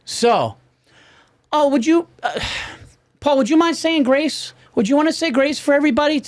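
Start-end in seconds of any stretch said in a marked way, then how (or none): 1.5-6.2 high, sugary imitating voice; sweet mock voice. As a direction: mimic a sweetly pushy relative. Mimicking people volunteering him.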